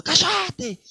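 frightened sailor shouting; shouted again. Retold or imitated. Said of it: "para la chica que estaba llorando".

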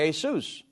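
"Jesus" said as hay-SOOS, the Spanish way. Shown as a demonstration. Host contrasting Spanish with English: "Jesus" as hay-SOOS. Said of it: "If I was speaking Spanish".

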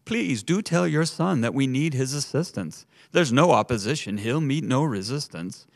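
panicked pleading. A narrator's reading. Waiters on their knees begging the mother, panicked and rising. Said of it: "voices all chorused in panic and grief".

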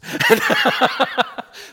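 loud, high, airy burst of laughter. Real, not acted. Telling the class a funny story, bursts out laughing. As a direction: break into a big laugh at the punchline.

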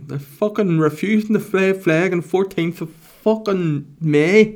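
high, loud, slow put-on rant; mock ranting local. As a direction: rant like an outraged flag-waver. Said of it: "imagine going home going".

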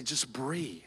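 very soft, low and hushed; soft soothing. Acted. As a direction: soothe a panicking child, barely above a breath. God calming you like mother.

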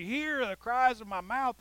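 high, loud, slow prayer cry; bold confident cry. Retold or imitated. Preacher modelling a confident prayer, slow and loud. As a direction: call on God with boldness. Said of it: "With this boldness, with this confidence".

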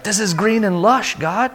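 high-pitched astonished exclamation to God; amazed. Retold or imitated. Traveler marvels to God at the greened land, amazed. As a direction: marvel aloud, voice lifted high.

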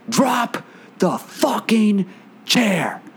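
slow, high-pitched angry demand; retold angry scream. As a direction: hammer out each word high, imitating her fury. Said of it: "like, scream at this guy".

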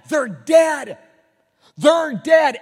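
loud, high repeated declaration; preaching shout. Real, not acted. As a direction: hammer it home to the church. Emphatic preaching.

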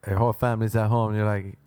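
ordinary talk

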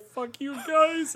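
high, loud and slow mock yell; mock outburst. Mock telling caring friends off, high, loud and slow. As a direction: bellow it in a hammed-up tantrum. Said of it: "And I'm like".

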